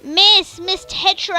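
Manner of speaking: high, loud call in a put-on voice; first try at a crewman's call; weird character voice. Crewman calling to his boss Tetra, high put-on voice. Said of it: "giving everybody weird voices".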